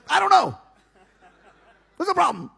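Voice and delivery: high, loud exasperated reply; frantic retort. Retold or imitated. Answering the calm wrestlers, frantic and loud. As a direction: snap back, high and laughing.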